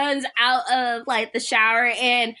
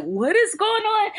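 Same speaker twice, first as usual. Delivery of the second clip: high, bewildered retold question; Sue; 10 semitones higher than usual. Acting out Sue as Carrie grabs her, high baffled voice. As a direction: imitate baffled surprise, pitched up. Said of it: "Sue was like".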